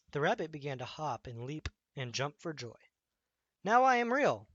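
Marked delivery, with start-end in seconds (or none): 3.6-4.5 much higher, louder triumphant cry; laughing joy. Acted. Newly real rabbit laughs, high and triumphant. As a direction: crow it out in delight. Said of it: "he laughed".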